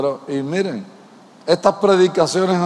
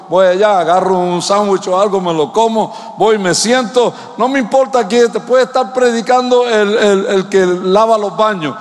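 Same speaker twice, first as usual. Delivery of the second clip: louder, higher, driving the point home; preaching at full force; 6 semitones higher, 5 dB louder than usual. Acted. Pastor urging the church to receive the word, full preaching voice. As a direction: preach it out to the congregation.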